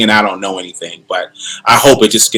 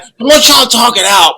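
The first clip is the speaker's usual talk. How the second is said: put-on high, raised-voice dad telling kids off; imitating himself as dad; 10 semitones higher than usual. Dad orders his two kids to talk, hammed-up parenting voice. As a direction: play the peacemaking father at full pitch. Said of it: "That's the dad in me".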